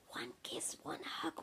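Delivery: put-on soft child's whisper. A narrator's reading. Ben asks Daddy without waking Ursula, whispering. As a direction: whisper the request very softly. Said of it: "whispered Ben".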